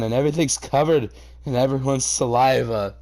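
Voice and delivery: ordinary talk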